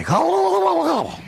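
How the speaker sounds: put-on a toddler proudly gobbling back; child's turkey gobble. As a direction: grandson answering with a turkey noise. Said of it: "And Michael would say".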